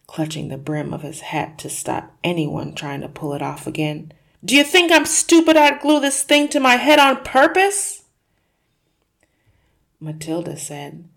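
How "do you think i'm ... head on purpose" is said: high, loud, exasperated yell; angry shout. Acted. Husband furiously defending himself to his wife. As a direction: rage at the very idea. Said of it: "Mister Wormwood shouted".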